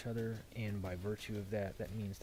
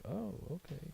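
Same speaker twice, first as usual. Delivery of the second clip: put-on higher, unbothered old man shrug; a hint of a dismissive old man; lightly voiced old man; 9 semitones higher, 3 dB quieter than usual. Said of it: "Rao, the old man, is just kinda like".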